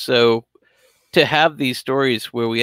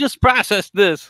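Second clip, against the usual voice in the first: gentle reassurance; 8 semitones higher, slower than usual. Retold or imitated. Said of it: "It's like".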